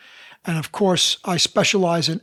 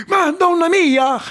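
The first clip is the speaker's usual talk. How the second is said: high, slow, amazed exclamation; astonished; 11 semitones higher, 5 dB louder, slower than usual. Retold or imitated. Old lady in Little Italy astonished, higher and louder. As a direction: cry it out in disbelief. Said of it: "She goes".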